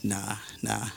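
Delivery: very soft, low mumbled brush-off; low-key, dismissive murmur; unbothered refusal. Retold or imitated. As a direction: imitate someone too sleepy to care. Jonah shrugs off the sailors.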